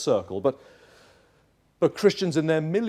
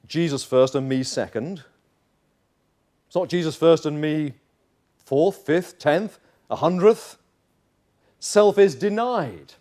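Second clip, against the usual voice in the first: ordinary talk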